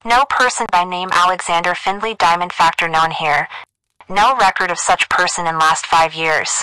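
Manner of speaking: high, filtered telephone dictation; girl over the wire. A narrator's reading. Operator dictates a cablegram to the doctor, high telephone voice. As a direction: telegraph operator reading out through a phone line. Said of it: "A girl's voice announced over the wire".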